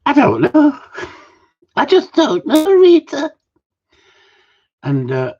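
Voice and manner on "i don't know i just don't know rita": very high, loud falsetto mock wail; exaggerated high-pitched helplessness; put-on female character. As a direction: squeal it in a hammed-up woman's voice. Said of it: "And she go".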